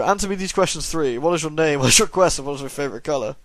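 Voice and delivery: normal talking voice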